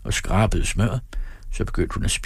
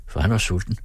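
plain reading voice

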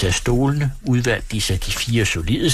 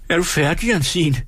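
higher, calling down the hatch; 8 semitones higher than usual. Acted. Innkeeper calls down through the hatch to the maid. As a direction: shout down to the maid. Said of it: "lød Brasens røst fra lemmen".

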